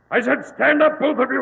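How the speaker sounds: loud, high furious command to stand; shouted order. Acted. Villain at gunpoint yells at the couple, furious shout. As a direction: bark it at them at full force.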